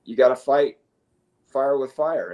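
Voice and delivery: normal talking voice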